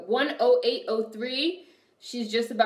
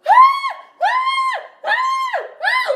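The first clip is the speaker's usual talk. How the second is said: three piercing high screams of pain; panicked screaming; 12 dB louder, much slower than usual. Retold or imitated. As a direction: scream like you just saw your own blood. Retelling hand cut, "I was like.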